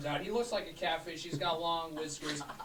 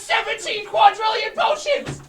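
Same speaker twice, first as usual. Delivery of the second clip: loud, higher, laughing despair; mock wail; 20 dB louder than usual. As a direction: howl over the lost potions. Seller loses wagon, wails.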